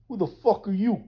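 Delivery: mock wiseguy challenge; Guido voice. Mocking Denmark in a Guido voice, tough wiseguy challenge. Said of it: "why I'm talking like Guido".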